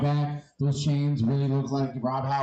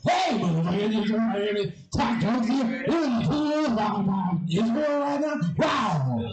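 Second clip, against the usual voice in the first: hyped game announcer, higher; 6 semitones higher than usual. Pretend. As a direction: call it like an arcade announcer. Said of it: "we go".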